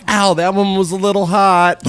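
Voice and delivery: high, loud mock whimper of pain; high, loud playful ouch; mock stripper whine. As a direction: whine it like a stripper burned by a coin. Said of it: "and she's like".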